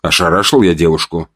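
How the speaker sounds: ordinary delivery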